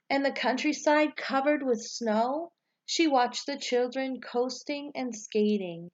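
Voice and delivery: put-on reading a picture book aloud to children, lively and rising at each new season; bright, expressive read-aloud storyteller voice; story narration. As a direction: tell it like story time, lifting the start of each season. Teacher reads storybook aloud.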